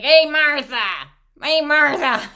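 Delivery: hammed-up farmer's shout; hollering husband. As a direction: holler at the wife, pitch up. Husband hollers across the field.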